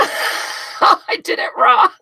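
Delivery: real laugh breaking through high-pitched words; giggling so hard the words squeak out. Real, not acted. Laughing at her craft mistake.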